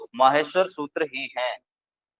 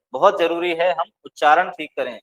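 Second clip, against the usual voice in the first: normal talking voice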